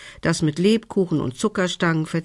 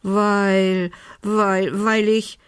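halting, higher-pitched stammer; 4 semitones higher, much slower than usual. A narrator's reading. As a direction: falter and restart, voice raised. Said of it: "stotterte Belinda". (stockend und stotternd, mit höherer Stimme)

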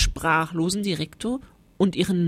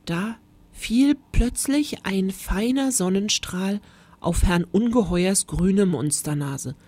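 ordinary delivery, nothing marked

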